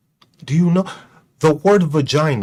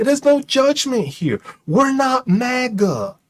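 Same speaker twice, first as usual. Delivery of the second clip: mock reassurance; 6 semitones higher, slower than usual.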